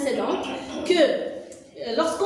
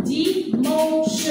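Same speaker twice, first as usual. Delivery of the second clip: "Dimanche" split in three beats, di-man-che; syllable by syllable. Shown as a demonstration. Teacher counting three syllables, "Dimanche" broken into di-man-che. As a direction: sound out "Dimanche" with each syllable separate, final che voiced. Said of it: "Trois syllabes".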